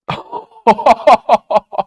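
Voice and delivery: high, loud burst of laughter; helpless high-pitched laughing fit; real laugh. Real, not acted. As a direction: burst out in a rapid high cackle. Laughing at a weird drawing.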